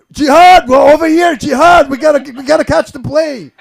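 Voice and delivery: loud shout over the crowd; calling out across airport. Pretend. Late for a flight, shouting for her at the airport. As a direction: yell her name to hurry her along.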